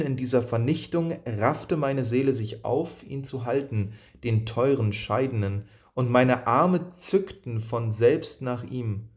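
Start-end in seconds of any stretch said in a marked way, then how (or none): none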